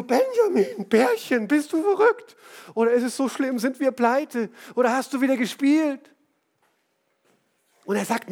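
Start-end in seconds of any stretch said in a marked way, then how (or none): 0.0-6.1 entsetzt ungläubig. Retold or imitated. Frau erschrickt über Hausverkauf.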